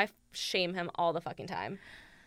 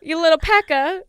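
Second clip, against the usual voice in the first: mock British slang; 7 semitones higher, 12 dB louder than usual. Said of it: "British people even call it".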